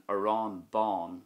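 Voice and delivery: "aráin bán" with both á vowels long and loud; stressed a fada. Shown as a demonstration. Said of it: "the a fada that is being stressed".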